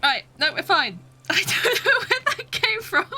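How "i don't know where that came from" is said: high, helpless giggling disbelief; baffled laughter. Real, not acted. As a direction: laugh through it, baffled at yourself. Baffled, laughing at own slip.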